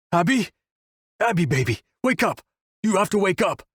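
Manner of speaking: high-pitched, desperate pleading; alarmed heartache. A narrator's reading. Wolf tries to wake Abigail, alarmed and heartbroken. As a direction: beg a loved one to wake, pitch jumping up. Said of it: "The alarming heartache ripping through the air".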